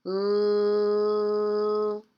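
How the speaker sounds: "L" as a long loud held sound; isolated first sound. Shown as a demonstration. Teacher asking children for the letter, held L sound. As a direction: hold the L sound, not the letter name. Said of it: "what's that first sound".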